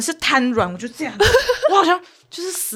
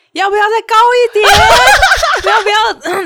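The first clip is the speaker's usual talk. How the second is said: higher, louder mock announcer laughing through it; teasing ride operator on megaphone; 9 semitones higher, 7 dB louder than usual. Operator taunting the riders over the megaphone, loud and laughing. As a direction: tease the riders like a gleeful operator. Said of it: "他开始用那个扩音的".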